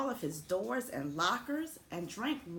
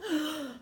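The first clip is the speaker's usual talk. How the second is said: sharp high gasp; hurt gasp; 9 semitones higher, 4 dB louder than usual. Acted. School overhearing the kids, sharp gasp. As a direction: gasp as if stung. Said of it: "the school gasped".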